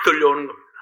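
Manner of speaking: normal talking voice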